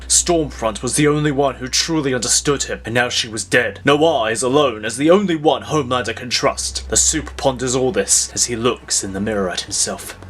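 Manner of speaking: normal talking voice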